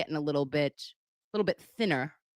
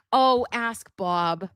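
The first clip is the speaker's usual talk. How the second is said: drawn-out greeting; 3 semitones higher, 6 dB louder, much slower than usual. Real, not acted.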